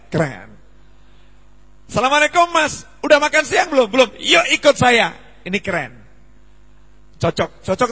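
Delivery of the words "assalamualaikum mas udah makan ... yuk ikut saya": cheerful invitation. Shown as a demonstration. Friendly greeting and invite acted.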